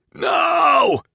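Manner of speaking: very high, loud anguished yell; anguished scream. A narrator's reading. Captain watching his teacher die, screaming. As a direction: scream it with everything. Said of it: "Nathan yelled at the top of his lungs".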